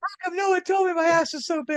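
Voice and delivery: high, fast squeaky whine; shrill Spaceballs character whine; Spaceballs impression. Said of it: "reminds me of Spaceballs".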